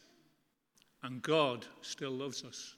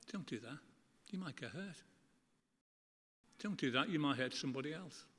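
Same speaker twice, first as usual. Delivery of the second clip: much softer, gentle warning; loving parent; 6 dB quieter, faster than usual. Retold or imitated. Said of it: "You love them".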